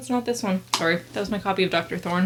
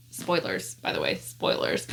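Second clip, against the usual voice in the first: whispered aside; 13 semitones lower, 6 dB quieter than usual. Real, not acted. Spoiler warning aside.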